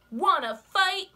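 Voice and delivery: high, loud, grumpy bug; grouchy ladybug voice. Acted. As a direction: bark the challenge up high. Ladybug challenges whale.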